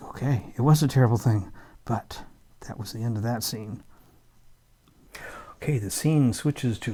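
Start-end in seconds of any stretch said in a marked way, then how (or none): none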